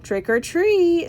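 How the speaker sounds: high, slow sing-song call; mom calling out. A narrator's reading. George's mom greets the children, sing-song call. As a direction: sing it out, drawing out the words.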